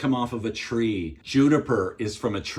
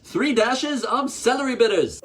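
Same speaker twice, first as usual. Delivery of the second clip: ordinary talk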